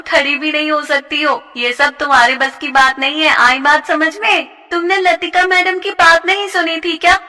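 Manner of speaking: taunting bully. Acted. A bully sneering at a dancing classmate. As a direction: crush her hopes with scorn. Jyoti and Prerna arguing.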